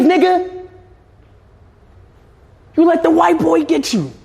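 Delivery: hype-man trash talk, high and pushed; high mocking taunt. Real, not acted. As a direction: voice way up, teasing and laughing at the dog. Taunting the losing dog.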